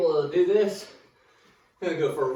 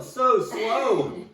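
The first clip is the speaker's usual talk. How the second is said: drawn out slowly; 5 semitones higher, 5 dB louder than usual. Acted. Complaining about slow timer.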